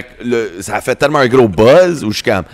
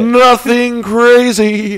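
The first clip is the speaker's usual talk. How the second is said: very high, loud, hugely drawn-out English; hammed-up English accent; 5 dB louder, much slower than usual. Shown as a demonstration. Guest playing down his accent, absurdly high drawn-out English accent. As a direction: hold each word high and long, showy anglophone voice.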